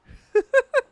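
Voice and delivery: high cackle at own joke; high laugh. Real, not acted. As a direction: burst into a shrill-pitched giggle.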